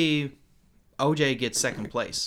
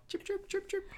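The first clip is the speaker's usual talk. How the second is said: soft high cricket chirps; chirping cricket voice; 10 dB quieter than usual. Shown as a demonstration. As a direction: chirp quietly like crickets at night. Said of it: "sound like crickets are going off".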